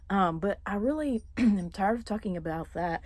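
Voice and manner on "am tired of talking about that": ordinary delivery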